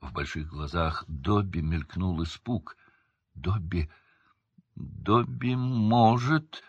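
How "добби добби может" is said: slow, uncertain, stretched words; hesitant drawl. A narrator's reading. Dobby daring to speak of old masters, hesitant drawl. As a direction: draw it out as if unsure. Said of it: "неуверенно протянул он".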